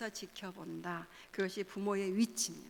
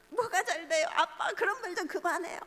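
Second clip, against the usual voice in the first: put-on high, louder teen protest at dad; mock angry protest; 8 semitones higher, 5 dB louder than usual. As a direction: push back at dad like an annoyed teenager. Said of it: "반박하면서 화를 냈어요".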